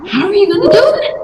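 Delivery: high, fast disbelief; incredulous. Acted. Doubtful investor reacting to a bold pitch, incredulous. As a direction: fire it off like a sceptic who can't believe it.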